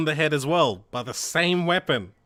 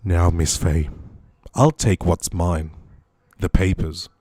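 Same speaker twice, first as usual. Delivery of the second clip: low, loud villain voice; deep-voiced villain making demands; bad guy; 9 semitones lower, 4 dB louder than usual. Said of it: "I wanna do a bad guy voice".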